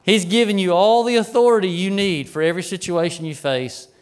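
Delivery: normal talking voice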